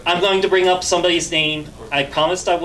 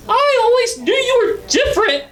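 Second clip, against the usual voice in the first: much higher and louder teary mom voice; mom's teary high voice; 6 dB louder than usual. Retold or imitated. Comedian voicing his crying mom, teary and high. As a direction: play the weeping mother, pitched way up. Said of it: "She starts crying immediately".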